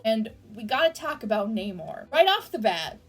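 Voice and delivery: ordinary talk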